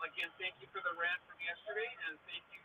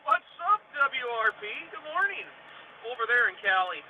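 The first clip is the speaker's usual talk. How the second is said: booming shout-out, louder and higher; loud greeting; 5 semitones higher, 13 dB louder than usual. Real, not acted. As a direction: call out the hello to the chat.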